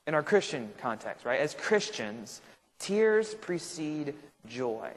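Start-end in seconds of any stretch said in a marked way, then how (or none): 2.8-4.9 very slow, deliberate words; weighty repetition. Real, not acted. Teacher repeating the key line to Christians, slowly and deliberately. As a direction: draw out each word of the key line.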